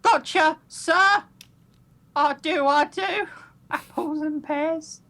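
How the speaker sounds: high, bouncy Cockney student, slow on the rhyming slang; chirpy Cockney girl answering the teacher; playful Cockney. Acted. Kendall replies to her teacher in chirpy Cockney.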